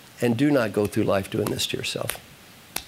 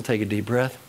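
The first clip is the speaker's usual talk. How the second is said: usual speaking voice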